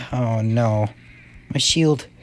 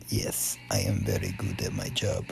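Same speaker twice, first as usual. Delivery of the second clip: very soft whispered aside; 13 dB quieter than usual. Acted. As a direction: whisper it to yourself, very low. Aside to himself while playing.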